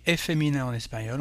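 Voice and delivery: ordinary talk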